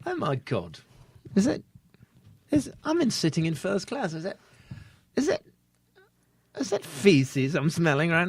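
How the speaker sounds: mock English accent. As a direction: play an outraged English first-class passenger. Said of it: "sometimes you could actually do an accent".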